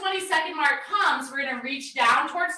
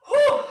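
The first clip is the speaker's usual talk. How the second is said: relieved whew; 11 semitones higher, 6 dB louder than usual. Real, not acted. Exhale after squat set.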